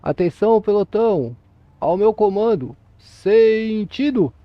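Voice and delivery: loud barked parade command, last word long and drawn out; military drill command. Shown as a demonstration. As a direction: call it like a drill sergeant, stretching the final word. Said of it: "vai falar assim".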